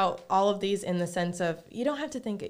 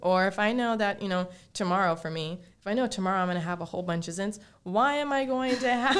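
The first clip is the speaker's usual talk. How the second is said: usual speaking voice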